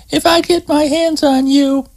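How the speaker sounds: high voiced young woman, cross and threatening; Terry fumes at the grinning prankster; angry Terry. Terry threatens Scott over her stolen clothes, high annoyed woman's voice.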